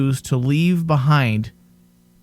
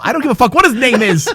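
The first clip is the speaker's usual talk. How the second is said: high, loud, rapid dismissal; exasperated outburst; 8 semitones higher, 5 dB louder, faster than usual. Real, not acted. Host fed up with a character's name, exasperated. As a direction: brush it off with laughing irritation.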